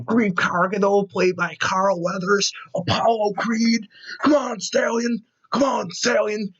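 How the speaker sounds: high, drawn-out excited exclamations; hyped-up fanboy cheer. Acted. Fan cheering Carl Weathers as Apollo Creed, hyped and high. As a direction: stretch each name out high in fan excitement. Hyped reaction to the actor appearing.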